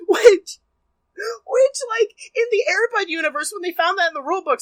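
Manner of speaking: high, fast, breathless fake outrage; hammed-up ranting. Host rants about the Air Bud universe, high and fast. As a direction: rush it out, pitch sky high.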